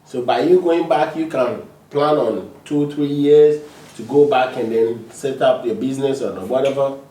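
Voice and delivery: usual speaking voice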